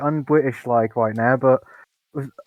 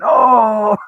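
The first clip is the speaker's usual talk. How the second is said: mock drunk shout; 8 semitones higher, 6 dB louder than usual. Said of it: "with them going".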